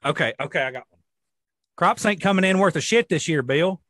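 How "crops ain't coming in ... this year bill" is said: louder country farmer voice; old farmer. Retold or imitated. Imitating farmers at a gas station, old farmer voice. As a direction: grumble about the harvest like an old farmer. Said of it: "the old farmers would sit in there and talk".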